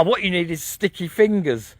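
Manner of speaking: teasing shout. Real, not acted. Teasing shout over struggle.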